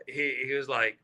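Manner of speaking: ordinary talk, nothing marked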